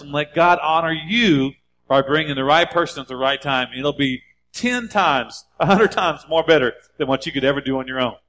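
rising exhortation, pressing the point home; fervent preacher's appeal to fathers; preaching. Acted. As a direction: urge the dads on from the pulpit. Preaching continues.

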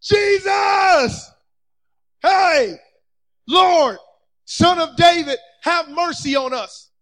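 high, insistent shout, easing at the end. Acted. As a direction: shout over a crowd to be heard. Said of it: "They just shouted louder".

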